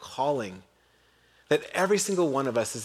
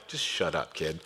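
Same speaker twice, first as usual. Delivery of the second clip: put-on dismissive older brother; 11 semitones lower, 8 dB quieter than usual. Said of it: "older brother, you're like".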